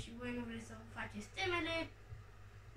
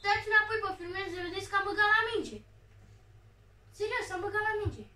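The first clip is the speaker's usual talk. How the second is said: loud, fast insisting on the replay; insistent protest; 6 semitones higher, 7 dB louder, faster than usual. Real, not acted. Gamer insisting to viewers he hit the ball, loud. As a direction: push the viewers to check the replay.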